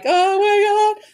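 high, drawn-out retold wail; mock crying. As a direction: wail it slowly, imitating panicked teenagers.

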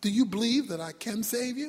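lightly voiced, calm heavenly questioner. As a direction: ask quietly as God would. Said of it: "The voice said".

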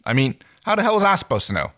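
high, fast exasperated outburst. Acted. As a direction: throw up your hands. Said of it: "threw up his hands in exasperation".

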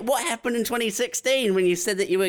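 high-pitched, incredulous mock questioning; mock interrogation. Going through Carrie's old resolution posts, mock incredulous interrogation. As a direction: press them with playful disbelief.

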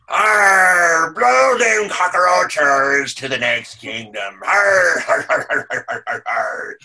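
loud, growling pirate roar. Pretend. Animatronic pirate actor roaring at park guests, hammed-up. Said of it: "It's like".

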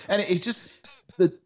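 usual speaking voice

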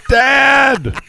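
high, hammed-up yell of Dad; mock yell. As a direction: yell it like a heckler at a rally. Mimicking rally yell.

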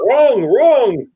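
mock heckling. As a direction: jeer it from the balcony. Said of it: "Booing me".

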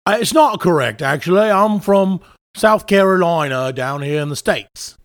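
indignant-sounding mock British accent; put-on British accent. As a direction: claim South Carolina in a broad British accent. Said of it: "I told you that's a South Carolina accent".

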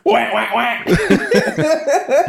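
slow mock wah wah echo; muffled wah wah talk. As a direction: drag out the wahs, imitating. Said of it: "What was that?".

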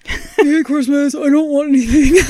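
put-on grouch, on the edge of laughing; Mark impression. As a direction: mockingly imitate a Christmas-hating husband. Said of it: "That's how he talks".

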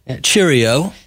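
cheerio in a playful British voice; mock British accent. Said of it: "That's the wrong accent".

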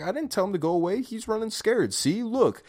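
high, mock-earnest coach voice; imitating the coach pointing it out. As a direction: pitch up as the coach, half-joking. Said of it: "He's like".

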